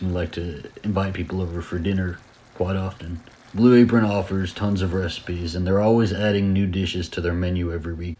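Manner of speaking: calm, steady sponsor read. Acted.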